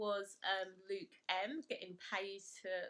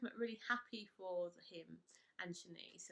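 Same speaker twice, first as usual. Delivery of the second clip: ordinary delivery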